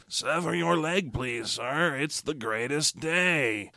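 hushed, slow mock French villain; bad French whisper. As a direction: murmur it slowly in a hammy French accent. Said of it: "a dude who whispers with a bad French accent".

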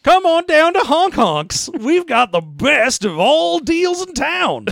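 high, slow, shouting ad barker; silly clown pitchman. Pretend. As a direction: bellow it like a goofy carnival ad. Parody ad pitch.

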